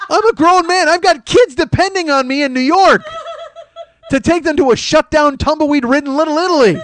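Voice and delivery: high, loud, hammed-up fury; mock outraged rant. To the gate agent, a hammed-up high loud rant. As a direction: rant as if about to bring the plane down.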